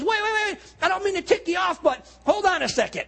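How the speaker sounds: Abraham calls out, pleading with the angels; urgent call. Retold or imitated. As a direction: shout after the angels to stop them. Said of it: "and he goes".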